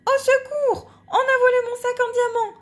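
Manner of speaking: high, loud, fast panicked cry; panicked lady; lady cries for help. Acted. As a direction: shriek for help as if robbed. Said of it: "La dame boîte à lettres s'écrie".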